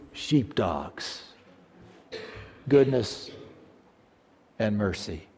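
quiet, very slow, fading to almost nothing; hushed preaching. Acted. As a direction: draw them in with a slow hush. Preacher drops to an intimate hush.

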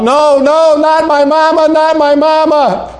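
screaming, high and loud, no, not my mama; anguished scream. Acted. Preacher voicing a girl screaming over her dead mother, anguished wail. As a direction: wail it out in disbelief, voice way up. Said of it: "beat on the walls and to scream out".

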